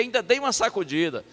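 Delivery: ordinary talk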